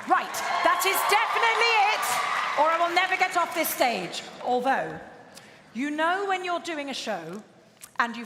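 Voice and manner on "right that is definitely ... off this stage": high, raised voice over the crowd; loud wrap-up. Real, not acted. Host fears never getting off this stage, loud wrap-up. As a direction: project firmly to cut yourself off. Cuts herself off over cheering.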